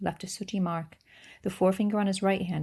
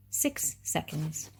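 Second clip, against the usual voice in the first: slow, even and calm; 3 dB quieter, much slower than usual. Acted. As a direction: say it coolly, unhurried. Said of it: "he glanced calmly".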